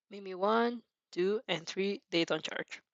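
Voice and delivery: ordinary talk, nothing marked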